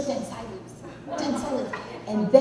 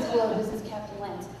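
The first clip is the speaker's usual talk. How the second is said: low, soft, smooth pilot voice; hushed intercom announcer; Captain Lance; 3 semitones lower, 6 dB quieter than usual. Acted. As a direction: do the trained airline captain. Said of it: "It's always".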